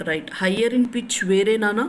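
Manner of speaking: usual speaking voice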